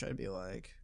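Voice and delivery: hushed under the breath; whispering. Real, not acted. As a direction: drop to a whisper. Whispered aside.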